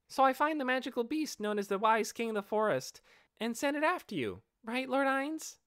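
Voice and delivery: high, bright-voiced young girl; a mischievous kid answering breezily; playful dark elf girl. Aura answering how long she hid, high playful girl.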